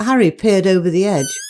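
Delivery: ordinary delivery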